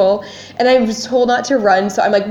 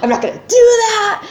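acting out an indignant high-pitched refusal; screaming; 11 semitones higher than usual. Germaphobe refusing the bathroom to her partner, screaming. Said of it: "but I'm screaming at her".

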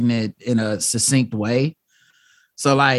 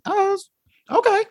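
very high, airy blissed-out voice; mocking him after meditation, high serene voice; zen meditator. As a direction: float it like a calm monk. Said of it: "He's like".

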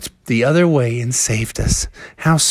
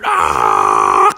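low, rough growling "ah"; storybook beast roar; bear's leaping roar. A narrator's reading. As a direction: roar like a bear landing its leap. Bear leaps into the boat.

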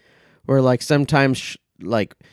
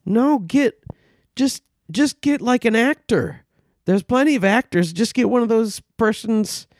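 higher, exasperated mock plea; mock exasperation; 9 semitones higher than usual. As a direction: act fed up and pleading for a real actor. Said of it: "And you go".

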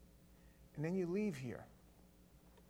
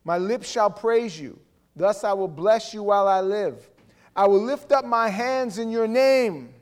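loud, higher, ringing declamation; full-force scripture proclamation; 5 semitones higher, 15 dB louder than usual. Real, not acted. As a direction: lift each line louder as a shout of praise.

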